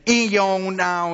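put-on slow, drawn-out southern accent. Man imitating a lady at the mall, southern accent. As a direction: stretch the vowels in an imitated southern drawl. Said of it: "very southern accent".

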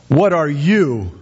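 slow, deliberate question halting on YOU; YOU drawn out, then falling; stress on YOU. Acted. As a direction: stretch "you" and let it hang. Repeats the demonstrated question for Elijah.

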